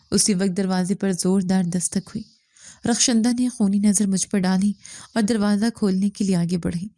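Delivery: ordinary delivery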